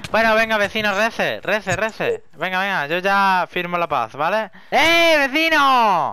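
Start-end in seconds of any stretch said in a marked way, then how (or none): 4.7-6.1 slow loud high call. Real, not acted. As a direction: call across the distance. Calling neighbor from afar.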